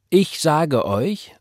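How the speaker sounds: slow, high, fervent announcement; impassioned church sister; emphatic testimony. A narrator's reading. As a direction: draw it out to command attention.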